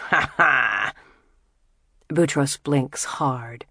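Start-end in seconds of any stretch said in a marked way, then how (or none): 0.1-0.9 put-on cruel drunken ha ha; mocking laugh. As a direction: laugh at him, jeering. Jamil starts laughing.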